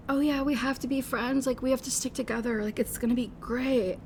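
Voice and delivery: lightly mock the eager new best friend; gushing new friend. Two outcasts befriending each other, gushing new friend.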